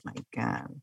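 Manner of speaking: whispered exasperated my God; unvoiced eye-roll aside; breathy aside. Real, not acted. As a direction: mutter it under your breath.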